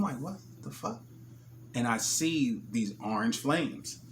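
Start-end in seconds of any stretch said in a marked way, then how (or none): 0.2-1.0 shocked. Retold or imitated. Sees bus on fire.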